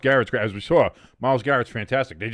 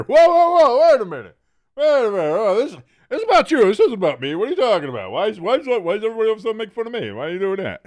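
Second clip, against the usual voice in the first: high, louder whiny mock protest; mocking hamster; 9 semitones higher, 5 dB louder, faster than usual. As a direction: imitate a defensive friend. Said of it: "hamster has never heard of the term".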